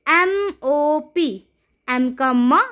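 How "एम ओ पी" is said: slow, letter by letter; slow teacher spelling-out. Shown as a demonstration. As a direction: spell it out slowly for the class. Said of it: "अगला देखिए".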